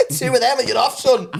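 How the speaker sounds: referee sending someone off, high-pitched; mock referee. Warning his mate off doing accents, mock referee call. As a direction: play a ref dishing out the card. Acts a referee showing yellow card.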